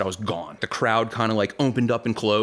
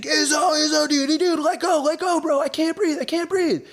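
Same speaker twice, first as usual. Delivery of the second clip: much higher, louder panicked shout; choked friend shouting; 3 dB louder than usual. Retold or imitated. Friend pinned in a headlock begs to be released, high and loud. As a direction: yell to be let go, half-strangled panic. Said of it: "He's like".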